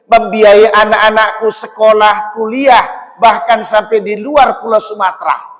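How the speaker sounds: loud, fervent thanks to Allah; grateful father's prayer. Acted. Father praying in gratitude for the pilgrimage. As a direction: pour out thanks like a father who never could afford Mecca.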